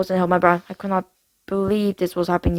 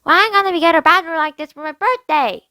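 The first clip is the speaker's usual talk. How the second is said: put-on little girl voice; 8 semitones higher than usual. A narrator's reading. Little sister whining.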